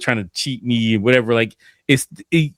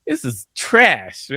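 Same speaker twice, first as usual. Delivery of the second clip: slow, soft, dragged-out yo this sucks; drawled disgust; 7 semitones higher, 4 dB quieter, much slower than usual. Retold or imitated. Studio artist to friend, weary slow groan. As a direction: groan it like you're fed up. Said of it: "and I'm like".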